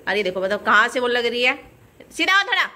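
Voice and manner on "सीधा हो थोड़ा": high, loud call to straighten up; called instruction. Real, not acted. Mother calls to her daughter to stand straight, high and loud.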